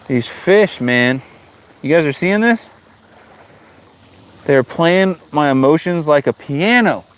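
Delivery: ordinary talk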